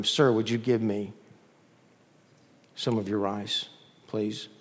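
low, softening plea; gentle request. Acted. Prince asking beggar one last time, gentle.

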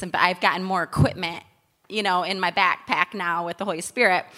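usual speaking voice

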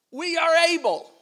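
loud, high, defiant proclamation; bold declaration. Acted. Preacher voicing the faithful spies, boldly. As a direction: ring it out with conviction.

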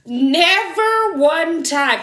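loud, high, stretched-out protest; incredulous, singsong exclamation; exasperated outrage. Real, not acted. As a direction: draw out every word, high and loud, in indignation. Outraged about the dropped character.

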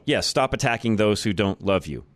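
usual speaking voice

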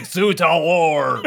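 slow, mumbled mopey Napoleon; depressed Napoleon. Retold or imitated. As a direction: drag the words out like a worn-out emperor. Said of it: "just like".

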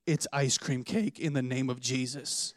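hammed-up holy solemnity; mock-solemn reveal. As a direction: drop low and slow as if pronouncing a blessing.

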